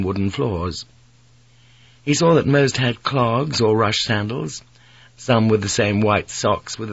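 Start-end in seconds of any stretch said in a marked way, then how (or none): none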